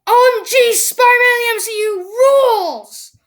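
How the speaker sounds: high, loud fan cheer; excited shout. Real, not acted. Kid opening his video with an excited cheer.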